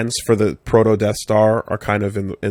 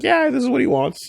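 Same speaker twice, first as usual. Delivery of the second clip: high mocking Grand Moff; 13 semitones higher than usual. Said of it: "but he's like".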